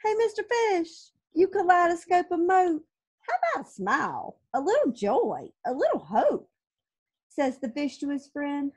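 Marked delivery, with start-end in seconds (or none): 0.0-6.5 high, sassy squid voice; cheeky squid. Acted. Impolite squid needling the fish, high sassy voice. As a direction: tease him like a slightly rude squid.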